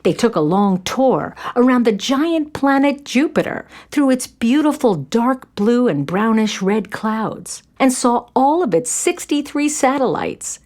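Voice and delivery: ordinary delivery, nothing marked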